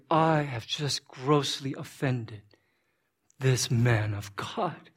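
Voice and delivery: slow, low, stricken realization; grave remorse. Acted. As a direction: weigh each word in dawning remorse. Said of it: "my lips begin to quiver".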